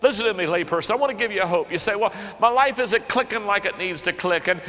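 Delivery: high, loud pleading preaching; preacher pleading with pastors and laypeople; fervent exhortation. Real, not acted. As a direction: urge them to take hope. Exhorting congregation.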